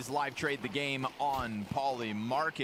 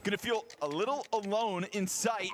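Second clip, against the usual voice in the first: rising, excited play-by-play call; esports caster; 6 semitones higher, 3 dB louder than usual. Acted. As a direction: build the call as the clutch unfolds. Live play-by-play casting.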